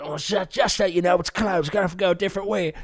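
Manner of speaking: lightly voiced cabbie breaking bad news; imitated taxi driver. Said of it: "he went".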